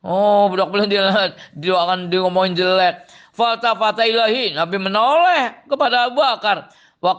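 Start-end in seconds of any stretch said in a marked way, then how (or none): none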